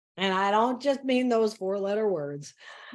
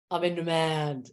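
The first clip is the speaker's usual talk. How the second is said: lowered voice; 7 semitones lower than usual. Shown as a demonstration. Swapping overwhelm for a new mantra, lowered voice. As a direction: drop the pitch and own it. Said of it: "I lower my voice".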